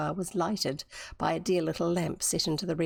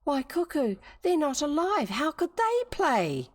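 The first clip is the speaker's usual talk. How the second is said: put-on high incredulous girl; 8 semitones higher than usual. Griselda protests toy mandarins.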